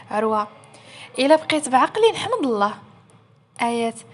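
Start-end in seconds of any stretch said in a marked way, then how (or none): none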